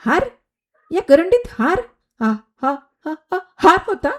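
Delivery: high, stammering amazement; astonished disbelief. Acted. Kajal gasps at finding a garland in the basket, stammering. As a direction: stammer out the shock of it.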